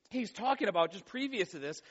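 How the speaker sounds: ordinary talk, nothing marked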